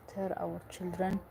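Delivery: usual speaking voice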